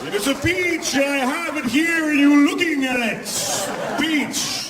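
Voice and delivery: high, slow, proud show-off voice; crazy peach pitch. Retold or imitated. To strangers across the street, high slow mock-crazy boasting. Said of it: "I just started going".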